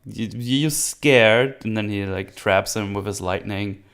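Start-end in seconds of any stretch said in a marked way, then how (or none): 0.0-1.6 mocking taunt. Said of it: "and Thor's like".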